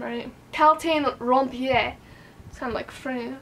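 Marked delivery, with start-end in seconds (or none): 0.5-1.9 Kaltain Rompier said slowly with a mock French accent. Shown as a demonstration. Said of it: "My lovely French accent".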